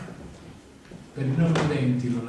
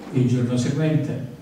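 normal talking voice

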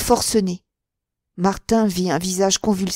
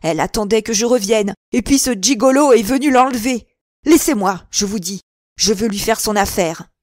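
angry rant; 2 semitones higher, 5 dB louder than usual. Acted. Rival raging against Martin.

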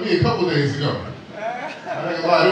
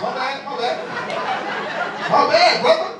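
high, flustered repeated apology; hammed-up panic, pitched up; nervous; 8 semitones higher than usual. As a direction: stammer out a mock-nervous apology. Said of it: "I got so nervous".

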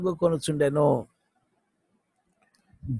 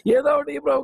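wrong way to ask; 6 semitones higher, 4 dB louder than usual. Shown as a demonstration. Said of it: "కాదు".

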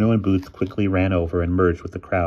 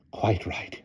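softer, contained huff; fuming; 2 semitones higher, 6 dB quieter than usual. A narrator's reading. Headmistress agrees about the faulty door, quietly fuming. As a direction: fume under your breath. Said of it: "fumed Miss Scrimmage".

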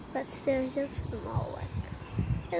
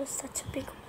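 hushed whisper, much softer; 10 dB quieter, much faster than usual. Real, not acted. Gamer eyeing a huge snake, hushed whisper. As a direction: whisper it as if the snake might hear. Spots big snake in game.

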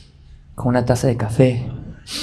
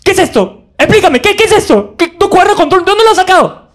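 much higher, louder mom voice; shrill mother; 5 dB louder than usual. Retold or imitated. Mother demanding answers from Job, high and loud. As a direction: interrogate him like his mom.

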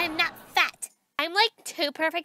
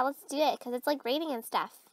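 ordinary talk

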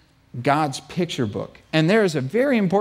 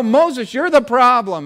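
accusing complaint; 7 semitones higher, 3 dB louder, slower than usual. Acted. Complaining people blame Moses.

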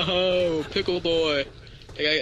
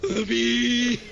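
mock shriek; 9 semitones higher, 4 dB louder than usual. Bee scream again.

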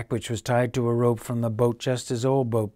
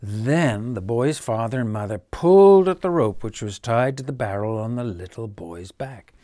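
usual speaking voice

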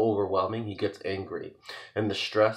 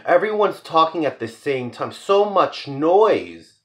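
louder and higher, slow on so much noise; overwhelmed cry; 8 semitones higher, 7 dB louder, slower than usual. Acted. Overwhelmed speaker crying out about the noise, louder and higher.

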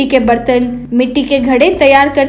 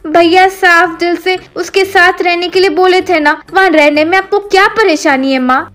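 higher-voiced daughter chiding her mother; reproachful daughter; 5 semitones higher than usual. Daughter blames mother's greed for the harm, higher reproachful voice. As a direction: lecture mother in a raised, girlish pitch.

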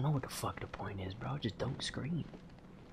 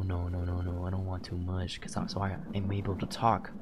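ordinary talk, nothing marked

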